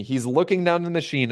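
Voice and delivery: ordinary delivery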